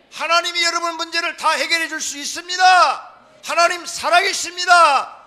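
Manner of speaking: building to high, very loud shouts; full-force preaching. Real, not acted. Preacher blessing the congregation, impassioned shouted preaching. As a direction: drive it up to a shouted climax.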